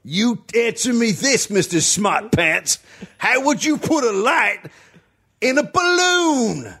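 hammy high challenge; old-timey witness. Pretend. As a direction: taunt him like an old-timer. Balloon guy challenging.

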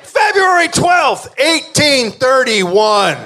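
slow booming date announcement; drawn-out shout; announcer shout. Acted.

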